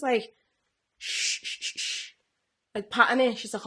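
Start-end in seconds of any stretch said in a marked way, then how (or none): 1.0-2.1 put-on breathy shushing as if to a baby; mimicking a girl soothing a fake baby. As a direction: hush the doll gently. Nanny shushing the doll.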